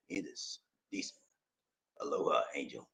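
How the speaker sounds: very low, very soft greeting; hushed deep message read-out; low suave voice. Acted. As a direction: drop it to a deep near-whisper.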